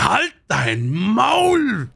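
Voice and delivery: very high, stretched-out squeal of disbelief; mock amazed exclamation. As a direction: cry it out like gushing over great news. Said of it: "Hast du das neue Video gesehen". (überdreht begeistert, hoch und langgezogen)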